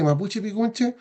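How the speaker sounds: ordinary talk, nothing marked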